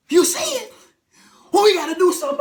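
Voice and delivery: high, loud shocked question; gasp, then shout it out; stunned shout. Retold or imitated. Passenger shocked that the pilot sees it.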